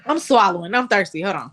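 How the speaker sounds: talking through a mouthful of water; mouth full. Real, not acted. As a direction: speak round the water in your mouth. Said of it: "You got your mouth full".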